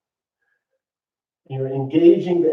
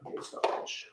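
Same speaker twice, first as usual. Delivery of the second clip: whispered command; 20 dB quieter than usual. Real, not acted. Voice command to phone again.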